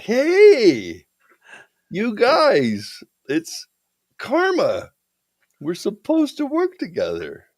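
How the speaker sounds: very high, hammed-up greeting; loud hey, then high-pitched mock appeal to pals; excited calling out. Calling to acquaintances in court.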